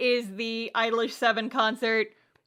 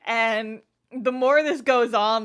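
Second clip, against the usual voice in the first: ordinary delivery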